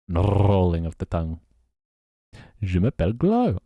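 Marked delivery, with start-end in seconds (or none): 2.6-3.6 French accent. Shown as a demonstration. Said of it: "quite good at doing the French accent".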